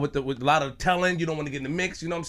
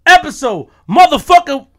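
loud, slow hype call; hype announcer; 10 semitones higher, 13 dB louder than usual. Real, not acted. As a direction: shout the episode open. Host hypes episode start.

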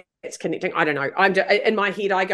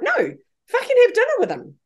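much higher, swooping, emphatic push; emphatic insistence; 13 semitones higher than usual. Retold or imitated. Repeating her advice to doubting mourners, emphatically insistent. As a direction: insist against their disbelief.

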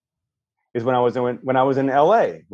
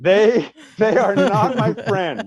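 laughing; 9 semitones higher, much slower than usual. Real, not acted. Laughing, high.

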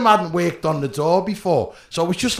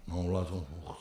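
low, much softer, worn-out moaning; exhausted groan; 11 semitones lower, 15 dB quieter, faster than usual. Retold or imitated. Bouncer worn out by clients, drained low groaning. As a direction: sag into it like you've juggled all day. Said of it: "And I'm like".

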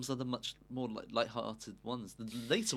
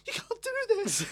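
very high-pitched mock protest; hammed-up, loud wail of dismay; Professor Trelawney; 6 dB louder than usual. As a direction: squeal it like a distraught teacher in melodrama. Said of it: "She's like".

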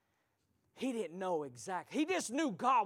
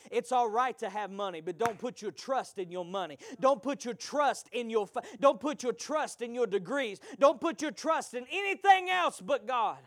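rising, louder exhortation; preaching; 6 semitones higher, 11 dB louder than usual. Acted. Preacher warning the church against false trust, building preaching. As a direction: build it up, hammering each don't.

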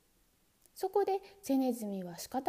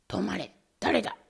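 small sharp voice; 3 semitones lower, 4 dB louder than usual. Acted. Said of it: "小さな鋭い声".

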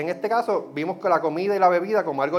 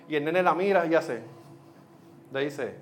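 ordinary talk, nothing marked

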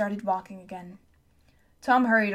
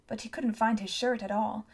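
ordinary delivery, nothing marked

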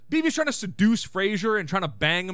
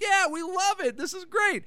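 high-pitched mock enthusiasm; imitating delighted audience; 11 semitones higher than usual. Housewives in the audience clapping along, imitated with high, gleeful approval. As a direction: gush like clueless happy fans, pitched way up.

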